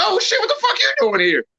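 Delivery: high, excited shout of welcome; happy surprise. Retold or imitated. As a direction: greet the brother, thrilled and startled.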